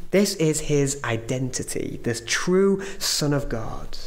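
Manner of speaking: ordinary delivery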